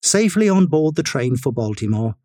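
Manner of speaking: usual speaking voice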